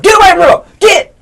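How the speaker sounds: put-on high, loud shout to shoo the kid; mock shout. An adult yelling at a child to get away, loud and high. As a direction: bark them off her, sharply and loud.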